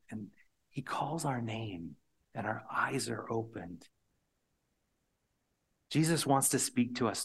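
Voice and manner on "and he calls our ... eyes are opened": normal talking voice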